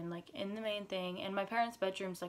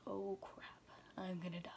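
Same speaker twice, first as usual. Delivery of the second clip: terrified whispered panic; scared whisper; 10 dB quieter than usual. Retold or imitated. Girl alone at home, whispering in fear. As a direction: whisper it like you're sure you'll die.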